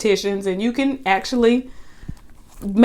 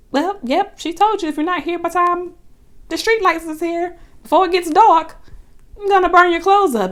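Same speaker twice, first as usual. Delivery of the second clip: higher-pitched matter-of-fact sass; lightly voiced sassy girlfriend; 8 semitones higher than usual. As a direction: tell him off, pleased with yourself. Voicing Angela's sassy reply.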